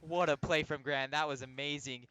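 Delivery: loud, thrilled praise; excited hype call. Real, not acted. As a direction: hype up the play. Hype call after a goal.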